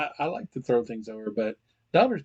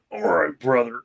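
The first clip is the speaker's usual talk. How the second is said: gruff wrestler promo; loud wrestler hype voice; Hulk Hogan voice; 3 semitones higher, 9 dB louder than usual. Pretend. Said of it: "I'm gonna do this in Hulk Hogan voice".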